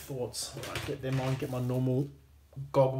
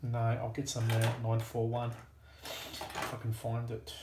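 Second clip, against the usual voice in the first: normal talking voice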